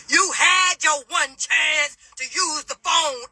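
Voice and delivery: slow, high, loud mock yell; imitated yelling. Officer refusing a detainee the phone, slow imitated yelling. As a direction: drag out each word, yelling like the officer. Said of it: "she just was yelling everything she would say".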